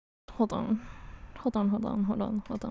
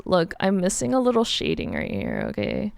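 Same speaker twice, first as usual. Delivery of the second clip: usual speaking voice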